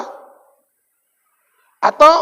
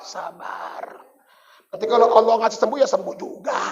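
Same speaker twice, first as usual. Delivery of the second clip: whispered sabar; 6 semitones lower, 8 dB quieter, faster than usual. Acted. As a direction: breathe Sabar like a resigned sick man. Lazy patient man answers. (bisikkan Sabar dengan pasrah)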